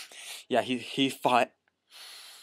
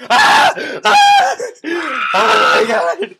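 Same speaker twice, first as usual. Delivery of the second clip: very high, loud shrieks; screaming; 9 semitones higher, 11 dB louder, much slower than usual. Real, not acted. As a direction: shriek at the sudden scare. Jump scare.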